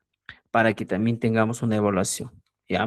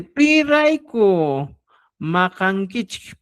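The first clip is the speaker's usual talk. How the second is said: slow drawn-out model; 5 semitones higher, much slower than usual. Shown as a demonstration. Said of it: "teacher models phrase slowly".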